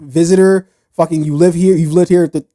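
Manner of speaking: mock American. Host mocking American attitude, loud ranting yank. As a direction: rant like a proud know-nothing American. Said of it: "here in America, we don't care".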